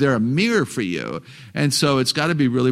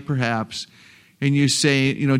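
ordinary talk

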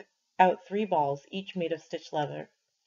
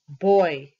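glaring; 9 dB louder than usual. A narrator's reading.

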